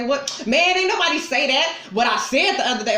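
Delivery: indignant mock comeback; mock defensive friend. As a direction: imitate someone half listening. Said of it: "just so they can say".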